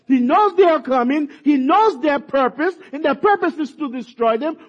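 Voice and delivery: ordinary talk, nothing marked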